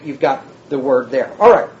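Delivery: ordinary talk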